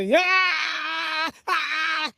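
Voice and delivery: high, drawn-out howl; long pained scream. Retold or imitated. Brother's hand held on the fire, pained scream. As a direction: wail in agony.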